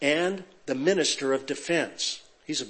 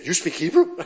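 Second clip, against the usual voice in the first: laughing. Retold or imitated. Bodyguards caught out, retold.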